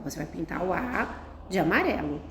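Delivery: ordinary delivery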